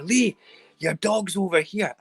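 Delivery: high, loud call across the street. Retold or imitated. Neighbour calls Lee from open door, calling out. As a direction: call to get a shouting neighbour's attention.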